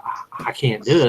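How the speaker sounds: voiceless, trembling "I can't do it"; frightened whisper. Retold or imitated. Last hunter too scared to wipe it off, frightened whisper. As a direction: whisper it in terror.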